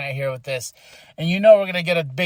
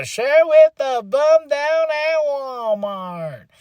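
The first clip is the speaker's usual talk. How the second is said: high, drawn-out catchphrase cry; hyped sign-off; 9 semitones higher, slower than usual. Acted. Host signing off to viewers, hyped shouting. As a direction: stretch the words high, building to a shout.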